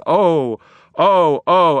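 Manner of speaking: high, loud anguished cry; screaming out. Acted. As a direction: wail it out, broken. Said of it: "screaming out".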